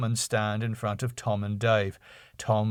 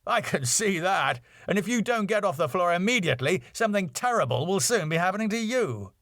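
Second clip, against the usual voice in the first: higher, fast exasperated Max; Max, irritated; 8 semitones higher, faster than usual. A narrator's reading. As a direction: warn him off under your breath. Max voiced in read script.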